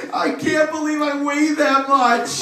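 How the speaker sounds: loud, high, slow mock wail; retold crying wail. Wife in tears over her weight, hammed-up wail. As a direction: bawl it out, drawn out, as an imitation. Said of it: "she starts to cry".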